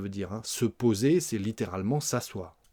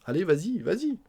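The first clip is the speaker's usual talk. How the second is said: urging on, higher and rising; encouraging; 6 semitones higher, faster than usual. Acted. Urging the other to order, encouraging. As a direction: nudge them along with a lifted "vas-y". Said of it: "on l'encourage".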